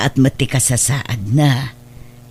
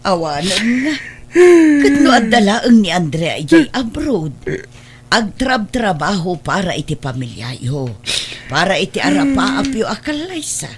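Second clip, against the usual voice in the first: crying; 5 semitones higher than usual. Acted. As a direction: cry out the words through tears. Mother grieving over absent Andrea.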